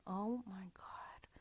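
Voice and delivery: slow, whispered disbelief; hushed, drawn-out horror; appalled whisper. Real, not acted. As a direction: whisper it slowly in real disgust. Disgusted reaction to gruesome story.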